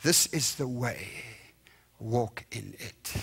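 soft and slow, hushed, like a still small voice. Retold or imitated. The inner voice of God telling the believer which way to walk, soft and slow. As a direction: let it fall to almost nothing, slowly. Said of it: "that small little voice".

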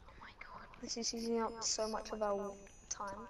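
unvoiced quick whisper. Real, not acted. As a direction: whisper it aside. Whispered aside.

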